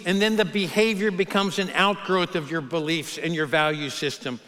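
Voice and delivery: ordinary delivery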